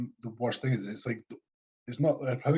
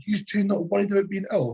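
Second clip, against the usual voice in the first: much higher prissy lady voice; mock her, pitched way up; mocking shop lady; 9 semitones higher, 3 dB louder than usual. Mimicking the shop lady fretting about illness, high prissy.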